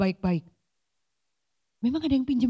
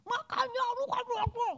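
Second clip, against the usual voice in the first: much higher, softer mock wife voice; a hammed-up wife nagging her husband; quarrelling wife; 11 semitones higher, 8 dB quieter than usual. Berantem sama suaminya. (istri yang mengomel saat bertengkar dengan suami)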